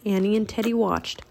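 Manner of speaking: ordinary delivery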